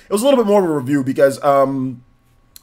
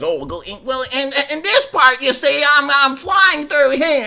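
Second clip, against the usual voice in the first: high, nasal mocking nerd voice; mock a know-it-all reviewer; 14 semitones higher, 4 dB louder, slower than usual. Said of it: "the nerd voice is funny".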